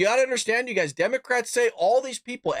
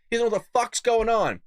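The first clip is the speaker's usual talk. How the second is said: ordinary talk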